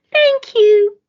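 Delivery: very high, loud squeaky thanks; a chorus of tiny grateful mice; squeaking mice. A narrator's reading. The mice thank George for the shoe, squeaking high. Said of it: "they squeaked".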